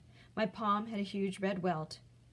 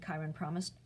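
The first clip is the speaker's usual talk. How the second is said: ordinary delivery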